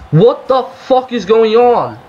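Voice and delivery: high-pitched, outraged complaint; real exasperation. Real, not acted. Gamer raging at lag that cost a goal, exasperated.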